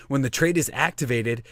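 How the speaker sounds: ordinary delivery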